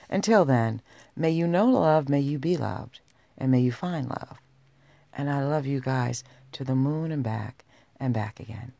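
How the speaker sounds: ordinary talk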